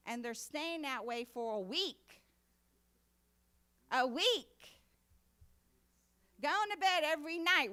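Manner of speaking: loud, high fervent preaching; preaching at full force. Acted. Preacher pressing couples not to stay angry, loud and fervent. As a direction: build the sermon to a loud fervent peak.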